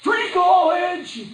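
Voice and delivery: high, loud and drawn-out campaign shout; a slow, hammed-up stump-speech yell; mock Bernie rally cry. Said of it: "The radical Bernies over here".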